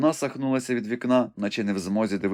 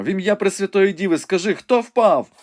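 urgent demand; 10 semitones higher, 6 dB louder than usual. A narrator's reading. Wounded Ivanhoe begs Rebecca to say who fell, loud and urgent. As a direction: demand an answer anxiously.

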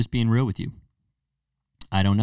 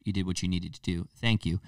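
normal talking voice

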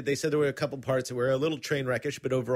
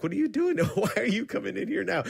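mock exasperation; 9 semitones higher than usual. Retelling dad scolding his interrupting daughter.

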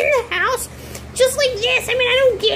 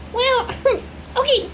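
usual speaking voice

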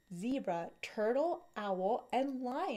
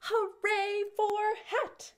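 cheering together; 7 semitones higher than usual. Acted. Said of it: "They all cheered".

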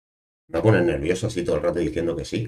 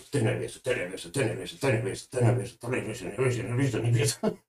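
higher, jittery nervous babbling; mock nervous jitters; 5 semitones higher, 3 dB quieter, faster than usual. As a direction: repeat it like you're having the jitters. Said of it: "tengo como un telele".

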